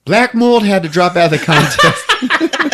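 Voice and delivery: laughing through the line; giggling retelling. Real, not acted. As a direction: repeat it while cracking up.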